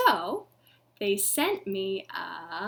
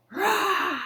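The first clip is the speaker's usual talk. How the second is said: playful higher lion roar; 8 semitones higher, 5 dB louder than usual. Pretend. Lion in the story.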